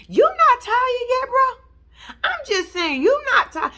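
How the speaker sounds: mock disbelief. Real, not acted. Mock challenging Rich.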